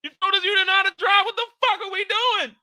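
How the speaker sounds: high, loud frantic shout; panicked yelling. Retold or imitated. Passenger yelling at the driver, high and panicked. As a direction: yell at her in panic. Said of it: "I'm like".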